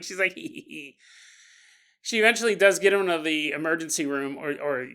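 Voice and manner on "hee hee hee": soft stifled snicker, hee hee hee; quiet snicker. Acted. Wife picking up dry cleaning, snickering quietly. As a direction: snicker under the breath as if hiding it. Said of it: "as she's snickering".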